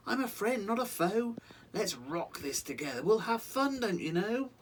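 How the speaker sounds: high, bright reassuring mouse voice; friendly mouse. Acted. The mouse telling the frightened lion he's a friend. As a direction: comfort the scared lion with a squeak.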